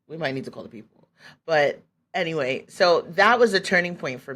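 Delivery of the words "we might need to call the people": quick, softer, lower mock aside; discreet onlooker aside. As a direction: say it fast under your breath, as a worried bystander. Imagined onlookers repeat it discreetly.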